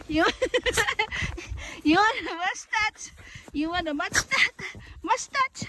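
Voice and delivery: high-pitched teasing of the dog about its snowy mustache. Real, not acted. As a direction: tease the dog in a high sing-song.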